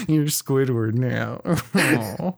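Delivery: slow, wheezy cartoon laugh through words; Squidward laugh. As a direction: laugh like Squidward while talking. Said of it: "that was the Squidward laugh".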